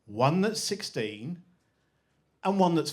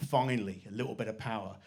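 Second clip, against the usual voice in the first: smug inner thought; 6 semitones lower, 5 dB quieter than usual. Retold or imitated. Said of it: "He thought".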